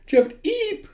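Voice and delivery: sudden very high yelp; zapped squeak. Acted. Teacher tased on the bus, sudden high squeak. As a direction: squeal as the shock hits.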